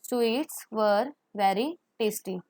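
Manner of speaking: very slow repeat, clear and even; slow model sentence. Shown as a demonstration. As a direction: repeat slowly for the class. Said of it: "तो हम कैसे लगाएंगे?". (धीरे-धीरे दोहराना)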